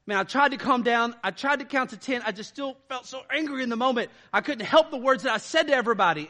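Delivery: imitating someone excusing their anger; acted exasperated excuse. Acted excuse of someone who got angry.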